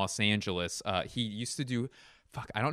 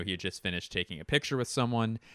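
normal talking voice

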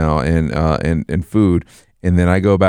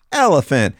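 toy voice; 7 semitones higher than usual. Retold or imitated. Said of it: "it goes".

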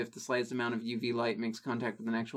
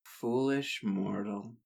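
lower, slow, menacing vampire; dramatic vampire voice; 5 semitones lower, 5 dB quieter, slower than usual. Pretend. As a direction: intone it like a dark vampire lord. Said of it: "if I might make an attempt".

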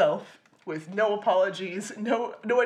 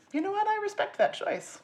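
much higher, prim mock-earnest approval; mock sincere approval; 10 semitones higher than usual. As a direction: play it like a gracious, overly earnest compliment. Mock approving reaction to Cage.

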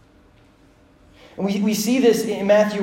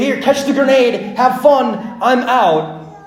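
loud, high, flippant exit line; mock careless send-off; 7 semitones higher, 7 dB louder, slower than usual. Imagined giver dropping a volatile gift, loud mock cheer. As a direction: toss it off loudly as a joke bailout.

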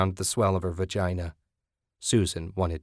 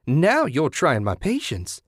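slow, high-pitched scolding; drawn-out, swooping reprimand; 7 semitones higher, 4 dB louder than usual. A narrator's reading. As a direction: scold it like a mother losing patience. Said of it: "Kathy scolded".

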